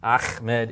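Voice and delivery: "Ahmed" said slowly as "Akhmed", with a grating kh; scraped throat sound mid-word in "Ahmed"; mistaken kh. Shown as a demonstration. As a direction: rasp the h of "Ahmed" into a kh. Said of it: "pronounced incorrectly as Ahmed".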